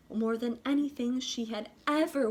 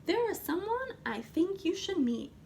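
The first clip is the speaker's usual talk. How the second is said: higher, friendly teacher; teacher's voice; 7 semitones higher than usual. A narrator's reading. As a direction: invite her in kindly. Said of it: "Missus Peachum said".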